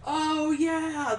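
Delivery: ordinary talk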